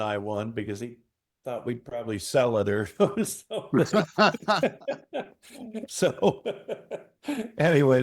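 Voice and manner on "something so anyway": laughing. Real, not acted. Laughing after punchline.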